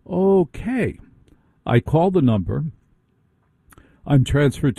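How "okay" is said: drawn-out, high-pitched mock-baffled okay; skeptical, retold reaction; dubious okay. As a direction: stretch it up high, humoring an absurd instruction. Baffled reply to banker's instructions.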